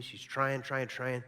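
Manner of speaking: ordinary delivery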